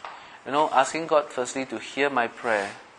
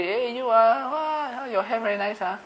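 much higher, gushing fake flattery; mock gushing compliment; 11 semitones higher than usual. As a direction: squeal the compliment like empty small talk. Mocking shallow chit-chat.

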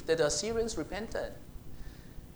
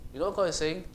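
usual speaking voice

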